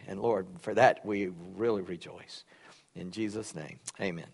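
soft, low prayer voice. Real, not acted. Pastor praying over the congregation, soft and reverent. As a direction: pray it quietly and reverently. Closing prayer before the sermon.

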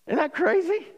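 high, incredulous question; amazed. Real, not acted. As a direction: pitch jumps up in wonder. Preacher marvels at the story.

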